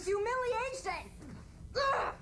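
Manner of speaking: very high anguished cry; anguished Spock. Acted. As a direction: wail the humiliation. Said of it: "Spock ... makes him emotional".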